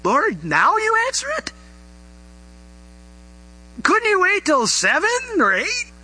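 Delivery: half-joking complaint about the timing; exasperated thought. Retold or imitated. Preacher woken at two a.m. complains to God, mock-exasperated. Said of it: "And you're thinking".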